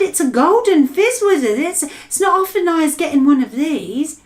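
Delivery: high, loud giant's delight; excited cry. Acted. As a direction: crow over a rare golden dream. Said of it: "he cried".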